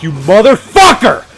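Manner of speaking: loud, slow, betrayed yell; angry outburst. Retold or imitated. Kid yelling at Joseph for telling his crush, loud and slow. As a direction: blast the friend who outed you.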